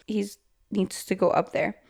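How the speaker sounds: ordinary delivery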